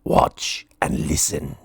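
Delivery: low, slow secret whisper; dragon whisper. A narrator's reading. Dragon showing Minnie the grinder, low whisper. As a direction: hush her to watch. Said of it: "said the dragon".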